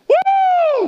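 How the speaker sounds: very high, loud woo; a sudden shouted woo of delight; whooping cheer. Real, not acted. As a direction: let out a big celebratory whoop. Preacher cheers after his punchline.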